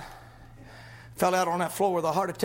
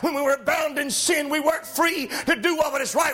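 rising, shouted gospel preaching; preaching at full force; 5 semitones higher than usual. Acted. Preacher to his congregation, shouting, fervent. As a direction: lift the congregation with fiery, shouting delivery. Preacher rises again on liberty.

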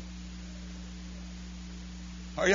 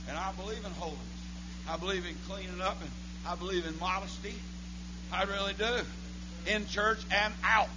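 much softer, almost murmured; soft-spoken preaching; 14 dB quieter, faster than usual. Shown as a demonstration. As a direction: say it gently, taking the edge off. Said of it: "I can say it again a little softer".

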